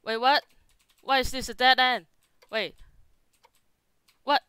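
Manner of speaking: loud alarmed yelling; panicked shout. Real, not acted. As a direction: cry out, cornered and scared. Chased into dead end.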